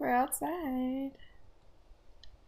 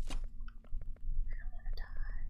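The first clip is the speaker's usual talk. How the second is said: scared whisper. Real, not acted. Player whispering to partner with low health, scared. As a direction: whisper it in dread.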